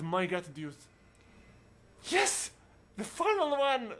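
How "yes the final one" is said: high, loud cry of delight; excited cheer. Real, not acted. Player thrilled to see the final vase handed over, excited cheer. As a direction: cheer it out, pitch way up.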